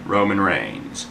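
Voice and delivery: slow, drawn-out announcer call; slow mock ring-announcer call of the matchup. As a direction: stretch each name like a ring announcer. Announcing the big match card.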